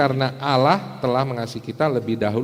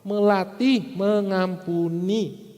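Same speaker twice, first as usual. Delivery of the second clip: "melatih mengampuni" drawn out slowly, pitched high; slow, high, deliberate stress on "melatih mengampuni"; underlined emphasis; 7 semitones higher, 3 dB louder, much slower than usual. Shown as a demonstration. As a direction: underline "melatih mengampuni", every syllable stretched. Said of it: "saya kasih garis bawah".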